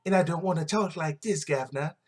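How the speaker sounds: hammed-up cockney British accent, governor. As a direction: parody of a British movie accent. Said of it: "talk with a British accent".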